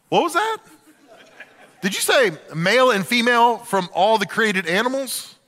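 can't believe what he heard; incredulous neighbor. Retold or imitated. Neighbor repeating Noah's answer in disbelief.

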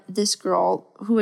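ordinary talk

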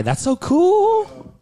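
high, slow mock gush. Teller mockingly gushes to Christine about her scarred eye. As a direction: fake admiration, drawn out.

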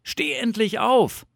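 high, loud shout to wake someone; impatient call. A narrator's reading. Fox outside wakes sleeping Bear, impatient call. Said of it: "rief eine Stimme".

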